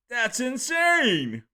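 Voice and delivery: very high, loud and slow amazement; amazed. Real, not acted. Streamer reacting to a brutal wrestling move. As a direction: stretch out the disbelief.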